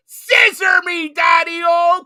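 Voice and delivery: high, loud, slow shouted catchphrase; wrestler catchphrase yell. Retold or imitated. Host to guest, doing the wrestler's famous line as a big shout. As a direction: belt it out like a wrestling promo. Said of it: "it's the whole".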